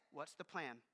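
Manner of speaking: low, soft answer; calm reply. Retold or imitated. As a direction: answer quietly, steadily. Objector versus God's answer.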